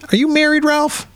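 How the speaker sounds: put-on very high, eager child voice; Judy matchmaking Ralph with her mom, in a high girly voice; little girl voice.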